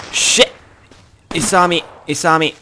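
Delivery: loud high panicked yell; panicked shout. Real, not acted. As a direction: shout in sudden alarm. Spotted by enemy sniper.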